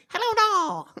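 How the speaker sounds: put-on very high, silly invented accent; minion-like voice. Starting a new accent for his mates, squeaky minion-like voice. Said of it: "Sound like a minion".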